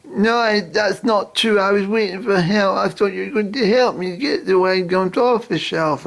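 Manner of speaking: put-on high, whiny little girl voice. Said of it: "the little girl said".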